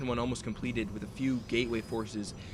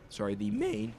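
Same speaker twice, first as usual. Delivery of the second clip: voice crack; 9 semitones higher than usual. Real, not acted. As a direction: voice cracking high on "main". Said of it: "Ugh, voice crack".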